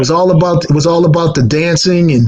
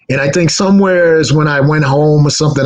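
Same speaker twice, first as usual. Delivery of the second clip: ordinary talk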